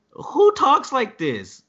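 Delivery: hammed-up incredulous rant; exasperated disbelief. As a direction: stretch it out in high-pitched disbelief. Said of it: "And I was like".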